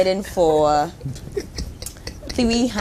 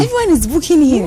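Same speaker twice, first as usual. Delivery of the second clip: host repeats it higher and louder; excited echo; 7 semitones higher, 5 dB louder than usual. Real, not acted. Host echoing the DJ, excited and raised. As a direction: bump it up higher and louder, thrilled.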